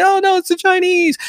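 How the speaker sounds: high-pitched mocking panic; mock alarm. Host imitating leftists worried about TikTok, high mock alarm.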